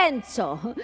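plain reading voice

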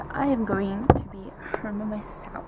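whispered aside; whispering. Real, not acted. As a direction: breathe it out in a whisper. Whispered in the car.

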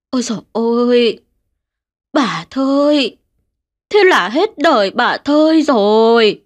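slow, drawn-out village lament; mournful lament. Acted. Neighbour grieving over old Thơi's death, slow lament. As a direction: wail it slowly, heads shaking. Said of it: "không kiềm chế được mà thốt ra".

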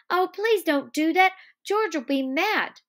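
high, loud anxious pleading; begging. Acted. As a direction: plead like someone afraid of trouble. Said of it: "he begged".

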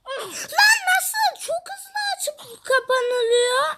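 high, shocked yelp breaking into a wail; shocked cry. Real, not acted. Gamer yelping at a trap that snaps shut, shocked cry. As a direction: cry out in disbelief at the game.

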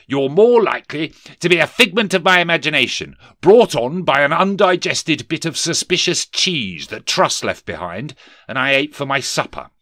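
high, loud sneering miser brushing off a ghost; a grumpy old miser waving it all away; scoffing Scrooge. Acted. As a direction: sneer it like a stingy old man who won't be spooked.

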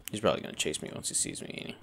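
quick hushed whisper; fast whisper. Real, not acted. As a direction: whisper it fast so it won't hear. Hiding from the monster.

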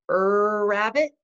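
"er, rabbit" with a drawn-out er first; wrong R sound. Shown as a demonstration. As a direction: stretch "er" before "rabbit". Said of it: "Do we say".

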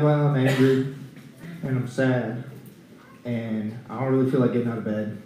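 low, soft, glum listing; downcast. Retold or imitated. Preacher entering symptoms on a website, low and downcast. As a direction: list symptoms glumly, voice sinking. Acting out depressed symptoms typed in.

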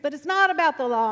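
riled-up preacher. Retold or imitated. Preacher to his cheering church, fired-up call. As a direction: imitate a preacher working the crowd. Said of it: "he would get into this kind of rhythm".

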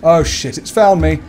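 loud panicked outcry. Real, not acted. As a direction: panic as it finds you. Monster finds player, panic.

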